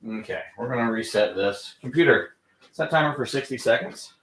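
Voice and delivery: normal talking voice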